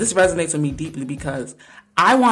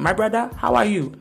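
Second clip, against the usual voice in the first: put-on African accent, pitched up; African-accented greeting, higher than usual; 5 semitones higher than usual. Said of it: "in the most African accent".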